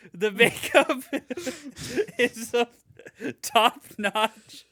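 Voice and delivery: laughing. Real, not acted. Cracking up reading review.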